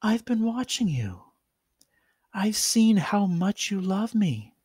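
put-on high, shy pony girl voice; bashful high cartoon girl voice. A narrator's reading. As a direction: confess it shyly in a high sweet voice. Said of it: "she said shyly".

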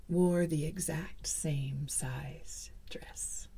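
slow, hushed, menacing; sinister whisper. A narrator's reading. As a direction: whisper it like a dark secret. Sinister closing reveal.

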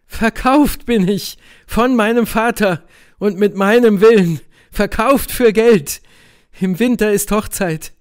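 high, loud, bitter confession; bitter Helene. A narrator's reading. (bittere Helene, hoch und laut)

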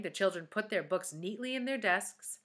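plain reading voice

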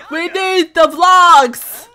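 slow, loud, high wail; wailed demand. Real, not acted. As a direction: stretch WE NEED THE VLOGS into a playful wail. Repeats demand, drawn out.

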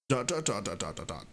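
ordinary delivery

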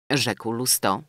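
ordinary delivery

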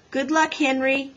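high, mild shout of encouragement; restrained cheering call. A narrator's reading. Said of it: "shouted Beezus".

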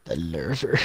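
low, drawn-out "lurver" for lava; mimicked pronunciation. Teasing Chris over how it is said, mimicked pronunciation. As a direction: imitate the friend's "lurver" in a deep voice. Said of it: "it's just funny how you say it".

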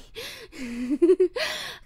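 loud, high unhinged giggle; high giddy cackle. Acted. Captor cackles over tied-up Wolfie, loud and high. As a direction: cackle with delight at your prize.

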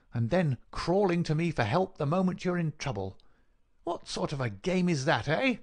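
high, indignant, taunting visitor's voice; scornful villain. Acted. Visitor taunts his accuser who begs help, scornfully. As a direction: round on him with mocking challenge.

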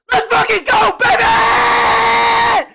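high, loud triumphant yell; hype shout. Real, not acted. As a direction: scream the win out. Celebrating a hard level.

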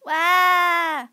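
put-on loud childish whine; whiny wail. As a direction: wail like a spoiled kid. Whine after refusal.

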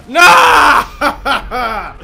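loud high laughing yell; laughing shout. Real, not acted.

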